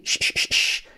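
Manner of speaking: unvoiced hushing "sh", repeated; drawn "sh" sound. Shown as a demonstration. Teacher showing learners the key sound of shame, hushing "sh". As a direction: say separate, soft "sh, sh, sh, sh" hisses. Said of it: "tienes que hacer así".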